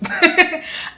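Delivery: high burst of relieved laughter. Real, not acted. Finding the lost binder, a loud relieved laugh.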